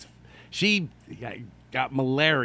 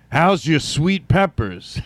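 loud, slow hammy come-on; cheesy pickup line; 4 semitones higher, 5 dB louder, slower than usual. Retold or imitated. Old guy hitting on beautiful women, loud and hammy. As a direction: ham up the smooth line. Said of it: "beautiful women and go".

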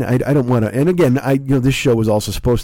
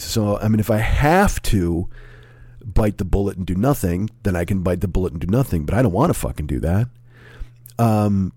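ordinary talk

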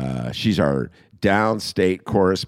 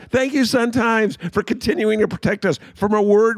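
mock gratitude; 13 semitones higher than usual. Mock thanks to newspaper.

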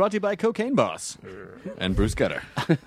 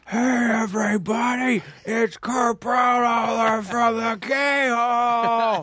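crowd in unison; 7 semitones higher, much slower than usual. Pretend. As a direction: shout it like a whole audience at once. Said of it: "the audience just as a unified body".

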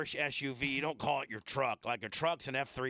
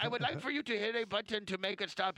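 mock wife calling OnStar; 3 semitones higher than usual. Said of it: "Hello, Chevy OnStar".

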